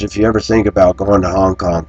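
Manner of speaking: ordinary talk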